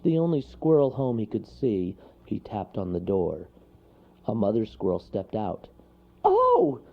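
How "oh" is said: very high, loud startled shriek. Acted. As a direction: shriek in fright. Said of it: "she shrieked".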